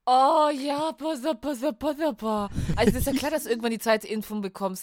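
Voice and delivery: loud insisting. Real, not acted. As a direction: demand they listen, loudly. Insisting, demanding attention in argument.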